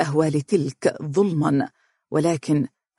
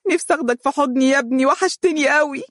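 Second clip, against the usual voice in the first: much higher, fast tearful longing; crying; 10 semitones higher, faster than usual. Acted. Umm Faris tells her son she misses him, crying fast. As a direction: rush the longing through tears. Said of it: "وهي تبكي قائلة".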